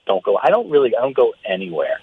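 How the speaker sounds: usual speaking voice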